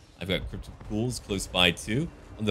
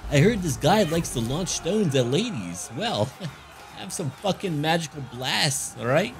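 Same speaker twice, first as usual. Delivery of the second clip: voicing the enchantress; 5 semitones higher than usual. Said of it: "I'm having a conversation with her".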